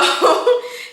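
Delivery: airy giggle burst; breathy high laugh. Real, not acted. Amused at her own description, giggling.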